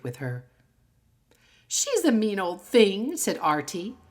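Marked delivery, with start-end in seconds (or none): 1.7-3.2 put-on higher, louder girlish grumble; a friend complaining on the way home; indignant schoolgirl. Schoolgirl complaining about teacher.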